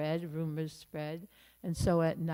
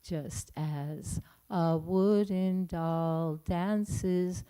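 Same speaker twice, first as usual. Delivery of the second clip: slow, solemn scripture recitation; slow, louder devotional chant-like reading; recited verse; 2 semitones higher, 4 dB louder, slower than usual. Acted. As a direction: intone it word by word like a prayer. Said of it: "right word for word".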